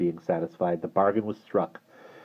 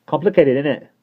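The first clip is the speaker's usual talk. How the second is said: put-on loud, bright London accent take; 8 semitones higher, 7 dB louder than usual. A narrator's reading. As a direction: lean into a cheeky London accent. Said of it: "doing a London accent".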